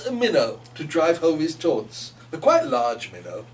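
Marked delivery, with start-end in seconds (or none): none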